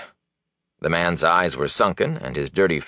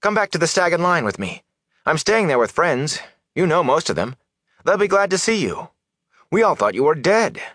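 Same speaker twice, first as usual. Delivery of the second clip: put-on high-pitched, friendly young villager; eager young man; 11 semitones higher than usual. Young villager inviting peddler to the inn, eager and friendly. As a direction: coax him along eagerly.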